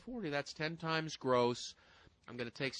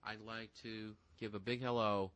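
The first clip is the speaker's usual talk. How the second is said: mock grand host intro, lower at the end; announcer voice; 3 semitones lower than usual. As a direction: introduce the guest like a showman.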